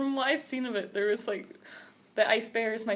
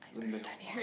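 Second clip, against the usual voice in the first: unvoiced whisper, much softer; whispered quote; 15 dB quieter than usual. Girl retelling the cartoon scene in a whisper. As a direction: breathe the words out.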